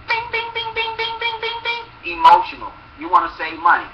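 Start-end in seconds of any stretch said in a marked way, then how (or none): 0.1-2.2 high, level bing bing like a bell; a sung high bell ding; chiming bell sound. Acted. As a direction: ring it like a game-show chime. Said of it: "sound effect, high steady".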